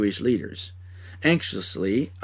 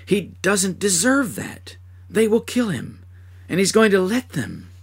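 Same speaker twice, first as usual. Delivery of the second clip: rising, higher voice in growing panic; blurting out the dread as it dawns; fearful dismay; 7 semitones higher, 3 dB louder than usual. Acted. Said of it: "filled with a terrible fear".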